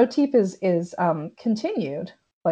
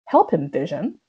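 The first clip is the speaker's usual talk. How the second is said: lower, flat urgent order; dropped, serious command; 4 semitones lower than usual. Retold or imitated. Wanda ordering Vision to save the choking man, lower. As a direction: drop out of sitcom cheer into command. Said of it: "it drops down and she's like".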